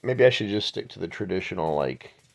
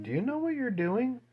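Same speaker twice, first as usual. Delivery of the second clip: pitched way up, puzzled; high questioning; 11 semitones higher, 3 dB quieter than usual. Retold or imitated. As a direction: ask it high and baffled. Dad quizzing sleepy daughter.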